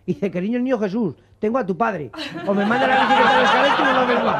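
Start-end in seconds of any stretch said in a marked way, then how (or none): 2.5-4.3 amenaza entre risas. Acted. Chiste, amenaza del niño riendo.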